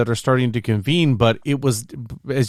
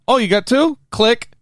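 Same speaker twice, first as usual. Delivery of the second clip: much higher, taunting retold quote; mock surprise; 13 semitones higher, 4 dB louder than usual. Co-host acts out the villain taking the card, mock surprise. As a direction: put on high-pitched feigned surprise.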